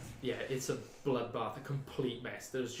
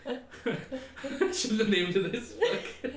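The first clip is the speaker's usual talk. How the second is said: slow words through real laughter; laughing; 9 semitones higher, 5 dB louder, much slower than usual. Real, not acted. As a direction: laugh while speaking. GM laughing at own name.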